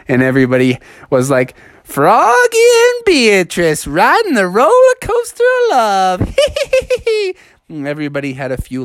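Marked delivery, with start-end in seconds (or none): 1.9-6.4 very high singsong taunt; high schoolyard teasing; teasing crowd. Acted. Crowd teases the couple. 6.4-7.3 very high acted tittering; put-on falsetto snicker; teasing giggle. Teasing kids laugh.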